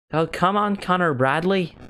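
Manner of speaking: high, lilting Northern Irish chant; put-on Northern Irish accent.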